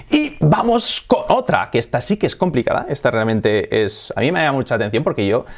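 ordinary talk, nothing marked

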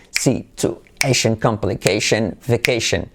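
very slow, broken-up words; slow, low-key teacher demo; slow syllable drill. Shown as a demonstration. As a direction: split the words into syllables for learners. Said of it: "Palabras de referencia".

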